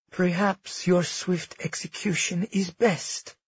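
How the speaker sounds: slow, soft and cold. Acted. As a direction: draw the words out coldly. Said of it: "Amara said slowly".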